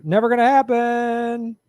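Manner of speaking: high, slow put-on dismissive voice; a goofy voice scoffing at the idea; mock voice. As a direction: drag it out in a silly character voice. Answering chat in a put-on voice.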